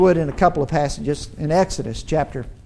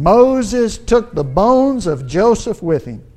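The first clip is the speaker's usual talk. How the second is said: scripture reading; 3 semitones higher, 5 dB louder than usual. Acted. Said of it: "look in verse nineteen".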